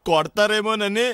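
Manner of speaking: slow, drawn-out mock crying; tearful whine. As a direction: whimper it out slowly, fearing a beating. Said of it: "మరి ఎందుకురా ఏడుస్తున్నావు".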